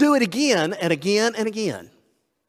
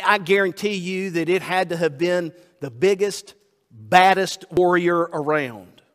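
ordinary delivery

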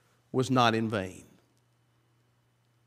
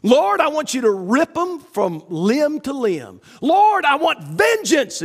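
a hint of raised, vengeful pleading; vengeful prayer; 8 semitones higher than usual. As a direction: pretend to demand revenge from God. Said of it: "he didn't say".

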